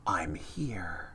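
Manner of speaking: breathy whisper. Acted. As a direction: whisper it like a secret. Whispered line in game text.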